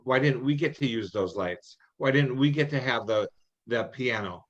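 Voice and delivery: ordinary talk, nothing marked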